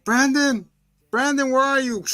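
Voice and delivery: mother searching for her son in the woods; calling mom. Retold or imitated. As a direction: call for him like a worried mom.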